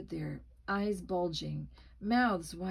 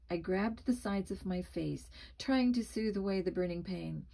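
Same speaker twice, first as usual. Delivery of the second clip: ordinary delivery, nothing marked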